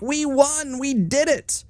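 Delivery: high, smug mock victory cheer; mocking smug politicians. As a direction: sneer it like a gloating politician. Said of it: "they're like".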